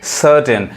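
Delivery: सर्टेन as 'SUH-ten', r silent; taught silent r. Shown as a demonstration. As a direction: say सर्टेन without the r. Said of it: "आर को हम नहीं पढ़ते". (सर्टेन को 'सटेन' बोलें, 'र' नहीं)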